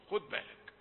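usual speaking voice

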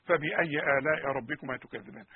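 measured chant of the verse; Quran recitation. Shown as a demonstration.

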